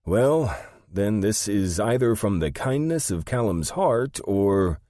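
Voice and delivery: high, loud character voice; high-voiced speculation, left hanging; Sandy, puzzled assistant.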